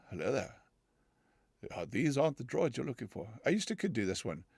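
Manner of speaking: ordinary delivery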